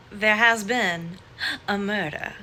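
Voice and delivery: slow, loud, ominous mystery narrator; dramatic murder announcement. Pretend. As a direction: announce it like a creepy whodunit.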